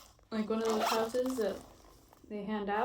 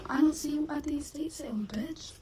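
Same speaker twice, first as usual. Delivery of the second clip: teasing whisper; 5 semitones higher than usual. Real, not acted. Teasing joke whispered at the sale.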